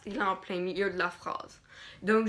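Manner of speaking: ordinary talk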